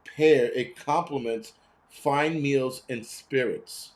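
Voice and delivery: slow, plush commercial voice, savouring each word; slow, hammed-up mock ad read; mock fancy ad read. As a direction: read the label like a luxury ad. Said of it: "I never read this till today".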